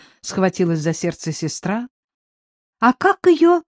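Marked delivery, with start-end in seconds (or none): 2.8-3.6 put-on high-pitched child's question; lightly voiced curious child; child suddenly asking. A narrator's reading. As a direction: pipe up suddenly as a little girl. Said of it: "вдруг басом осведомилась Шура".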